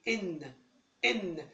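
"إن" said as the full name "en"; name the letter, vowel first: "إن". Shown as a demonstration. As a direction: say "إن" with its opening vowel. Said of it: "طب اسم الحرف دوت إيه؟".

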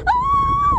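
high-pitched scream of fright; real squeal of alarm; startled scream. Real, not acted. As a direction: let out a sudden high shriek. Startled on a scary ride.

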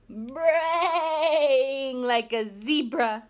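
slow, loud braying words. Acted. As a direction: bray it like a donkey. Zebra braying line.

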